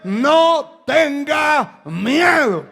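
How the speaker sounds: loud, high, slow preacher's shout; rousing exhortation. Real, not acted. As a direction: hammer each word out to the congregation. Preacher exhorts his listeners.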